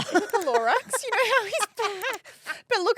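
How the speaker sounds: laughing. Real, not acted. Laughing about moustache.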